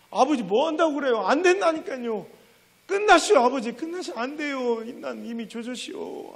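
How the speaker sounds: high, loud wailing plea through tears; boy crying loudly at his father to give up; sobbing plea. Retold or imitated. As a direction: wail at father, begging him to stop. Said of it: "그래서 막 울면서".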